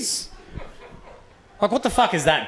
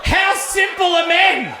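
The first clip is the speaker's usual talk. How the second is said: high, loud laughing outburst; incredulous laughing cry; laughing disbelief; 13 semitones higher, 3 dB louder, much slower than usual. Real, not acted. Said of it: "laughing disbelief".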